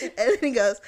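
usual speaking voice

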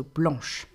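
blanche closing on a soft sh; French pronunciation. Shown as a demonstration. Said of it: "C plus H".

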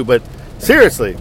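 ordinary delivery

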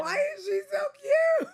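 very high, loud adoring squeal; gushing squeal. Real, not acted. Gushing over a friend's old picture, squealing. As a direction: squeal it out at the cuteness.

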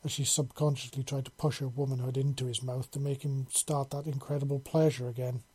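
usual speaking voice

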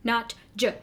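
ordinary delivery

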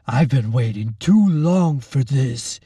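lightly voiced mocking orderly; gloating refusal. A narrator's reading. Orderly refusing Lacey's plea, gloating. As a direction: toy with her. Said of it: "he laughed".

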